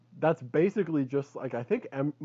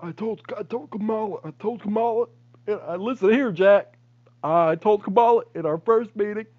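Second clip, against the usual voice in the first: higher, put-on old politician voice; mock Joe Biden; 7 semitones higher, 3 dB louder than usual. Friend tries a Joe Biden impression. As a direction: lean into "listen here, Jack". Doing Biden, then Obama remark.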